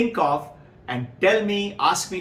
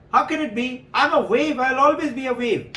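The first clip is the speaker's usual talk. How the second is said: indignant disbelief; 8 semitones higher, faster than usual. Acted. Wave protests it cannot die.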